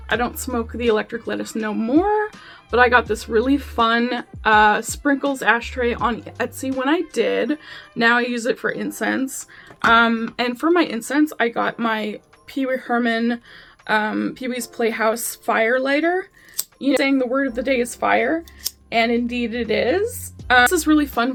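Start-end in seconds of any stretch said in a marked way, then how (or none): none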